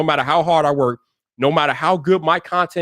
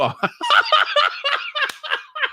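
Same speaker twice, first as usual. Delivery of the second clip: high, long peals of laughter; helpless laughter. Real, not acted. Streamer cracking up at his own slip, high laughter. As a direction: crack up completely.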